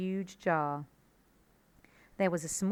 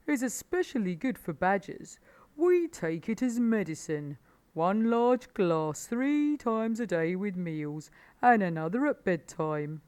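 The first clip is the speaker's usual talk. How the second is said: louder put-on animal voice, relishing each phrase; Badger character voice; 3 semitones higher, 5 dB louder than usual. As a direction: Badger holding forth, pleased.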